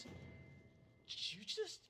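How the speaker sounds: incredulous bully, climbing very high; halting start into high-pitched outrage; Rog Barkrock. Acted. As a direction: stall on the word, then squeal it in disbelief. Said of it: "He looks at you and says".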